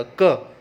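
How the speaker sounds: क said loud and crisp as a hard k; hard k sound. Shown as a demonstration. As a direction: punch out क as a clear k sound. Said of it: "सी का sound यहाँ pronounce करेंगे के की तरह". (क की आवाज़ ज़ोर से साफ़)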